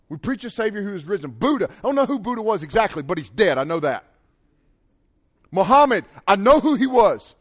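ordinary talk

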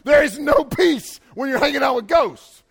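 loud, high panicked shouting; disciples freaking out. Pretend. Disciples yelling at Jesus they saw die, panicked shout. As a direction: yell at him in disbelief. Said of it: "And they're like".

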